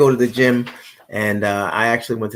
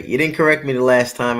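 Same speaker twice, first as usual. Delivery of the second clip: ordinary delivery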